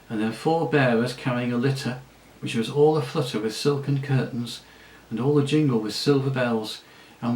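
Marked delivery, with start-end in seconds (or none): none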